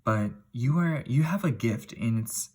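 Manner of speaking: ordinary talk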